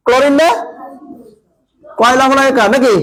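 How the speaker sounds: usual speaking voice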